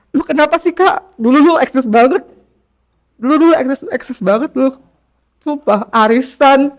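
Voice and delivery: a hint of a pal ribbing you about your old party life; teasing friend.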